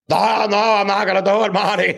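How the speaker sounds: high frantic refusal; Doc Brown. Acted. Comedian imagining a better reply to the actor, Doc Brown voice. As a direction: refuse in a high mad-scientist voice. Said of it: "that's Doc Brown".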